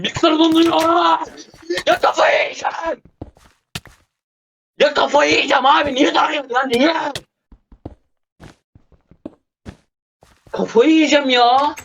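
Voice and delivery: very high-pitched, despairing wail of frustration; exasperated outburst. Real, not acted. Gamer raging at his freezing computer mid-fight, high exasperated wail.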